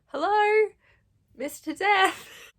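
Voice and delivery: high, loud, very slow mock-sweet Hazel greeting; drawn-out horsey-girl hello, way up high; hamming up a cheerful hello to Death. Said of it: "Hazel just going".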